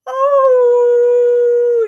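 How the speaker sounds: long, high, loud howl; coyote howl. A narrator's reading. Coyote mourns his old fur, howling. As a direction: howl at the moon. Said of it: "Coyote howls at the moon".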